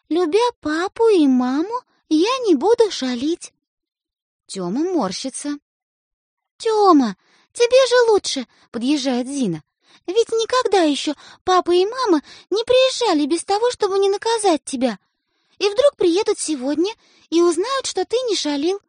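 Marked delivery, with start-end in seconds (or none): 0.1-3.5 high, slow and deliberate; dictating a vow. A narrator's reading. 6.6-8.5 high, louder insistence; big sister urging, high and loud. A narrator's reading. Sister urges her brother. 10.1-18.8 high, earnest persuading; pleading sister. A narrator's reading.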